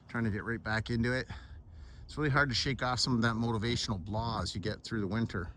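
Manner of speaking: usual speaking voice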